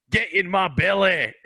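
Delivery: high, slow, hammed-up Fat Bastard growl. Kid quoting Fat Bastard at every meal, high and slow. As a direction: ham up the greedy fat villain, slowly. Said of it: "Fat Bastard, the big fat guy".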